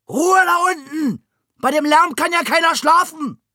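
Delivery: loud, high, furious shout; bellowing old neighbour. A narrator's reading. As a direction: roar it out of the window. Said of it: "sein Fenster aufriss und brüllte".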